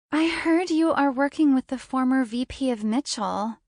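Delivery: bubbly blonde. Acted. The blonde's voiced line.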